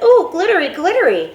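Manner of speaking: put-on high, loud, thrilled little boy; delighted child. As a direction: gush over the shiny thing like a small kid. Delighted child sees glitter.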